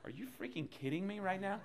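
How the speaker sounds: high, incredulous squeal; high-pitched comic amazement; mock disbelief. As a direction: act out being floored by the coincidence. Retold disbelief at answered prayer.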